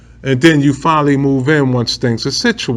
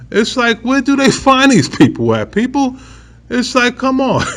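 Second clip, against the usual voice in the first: high, laughing disbelief; can't believe these people, half laughing; amused exasperation; incredulous; 8 semitones higher than usual. Real, not acted.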